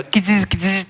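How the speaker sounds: mock mechanical creature sounds; mechanical Gizmo noise. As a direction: imitate the puppet's whirring clicks. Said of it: "He'd go".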